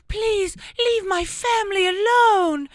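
high, loud, drawn-out fake European accent; Hilaria. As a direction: plead slowly in a hammed-up foreign accent. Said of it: "What is that accent?".

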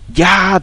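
high shrill scream of terror; a ghost-story scream in the hall. Acted. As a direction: let out a sudden piercing shriek. Friend screams in haunted inn.

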